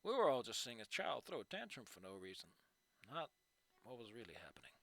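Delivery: soft, low reading of a fanfic; quietly narrated story; story narration. Acted. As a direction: read it out like an audiobook, hushed. Reads fanfic chapter aloud.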